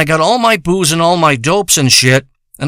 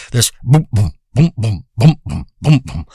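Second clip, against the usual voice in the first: thump out each boom like a pulse in the ears; heartbeat booms; 5 semitones lower than usual. Retold or imitated. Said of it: "hear the heartbeat in my ears".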